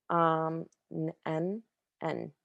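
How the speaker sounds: ordinary talk, nothing marked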